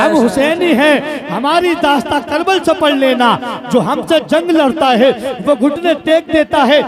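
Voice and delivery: high, loud, defiant oratory; full-force preaching. Real, not acted. Preacher warning those who insult the Prophet, full-force preaching. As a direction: shout it to the whole crowd in driving bursts.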